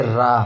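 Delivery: रा with the tongue buzzing; trill demo. Shown as a demonstration. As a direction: roll the रा. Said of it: "अब रा बोलेंगे ना". (प्राकंपित रा)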